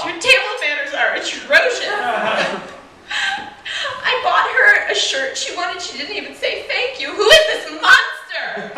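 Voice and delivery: high, loud hammed-up wailing parent; mock crying. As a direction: wail in fake tearful outrage. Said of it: "they're just, like, crying".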